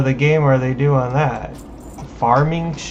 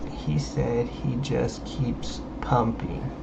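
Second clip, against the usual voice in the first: whispering; 3 semitones lower, 10 dB quieter, slower than usual. Real, not acted. Whispered retelling.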